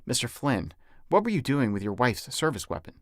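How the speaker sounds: ordinary delivery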